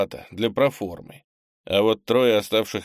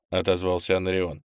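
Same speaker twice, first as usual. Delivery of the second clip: plain reading voice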